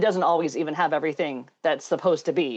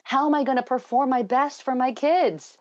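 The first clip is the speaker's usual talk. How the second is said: mock yelling; 7 semitones higher than usual. Mom pleading with God over sleep problems, mildly mock yelling. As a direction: imitate an upset outcry without really shouting. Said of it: "She was yelling at God".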